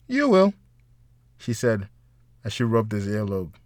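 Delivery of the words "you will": teasing, much higher; light playful tease; high playful woman. A narrator's reading.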